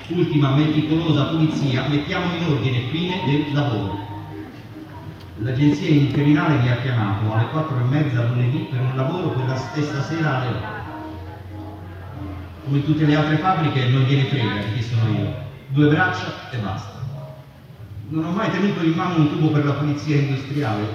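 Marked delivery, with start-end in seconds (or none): none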